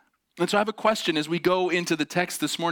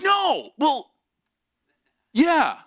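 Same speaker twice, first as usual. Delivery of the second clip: high, loud, halting backtrack; pitched-up grudging admission; defensive driver; 13 semitones higher, 5 dB louder, much slower than usual. Retold or imitated. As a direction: imitate a flustered driver denying, then conceding.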